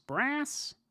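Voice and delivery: American "Brass?" with a flat æ, pitched high and questioning; a bad American take on "Brass?"; mock American accent. A mock American accent reacting to the chat. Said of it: "a really bad American version of".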